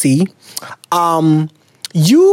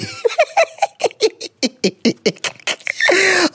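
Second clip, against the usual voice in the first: high crying laugh; 13 semitones higher, 3 dB quieter than usual. Real, not acted. Laughs at letter.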